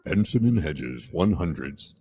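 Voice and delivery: low announcer tag line; chipper 1950s ad. Pretend. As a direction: read it like an old cigarette ad. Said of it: "just had to do that".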